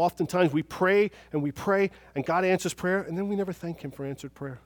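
usual speaking voice

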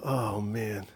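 low, soft, drawn-out dismay; reluctant groan. Retold or imitated. Preacher retelling his dread of apologizing, reluctant groan. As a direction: let it sink out like a tired groan.

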